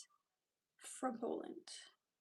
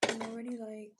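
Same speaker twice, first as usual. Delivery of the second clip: breathless; 3 semitones lower, 4 dB quieter than usual. Real, not acted. Out of breath after workout.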